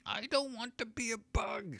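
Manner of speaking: high, shrill-pitched scream of a small halfling; high halfling screaming in panic; halfling's furious scream. Acted. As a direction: shriek it high and desperate. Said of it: "screams the halfling".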